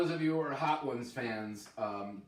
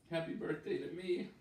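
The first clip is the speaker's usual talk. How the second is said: ordinary talk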